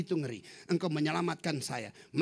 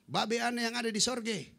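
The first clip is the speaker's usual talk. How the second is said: Betawi dialect; 6 semitones higher than usual. Retold or imitated. Preacher imitating a Betawi man praying, Betawi accent. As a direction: pray in a broad Betawi accent. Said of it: "Dengan dialek Betawinya".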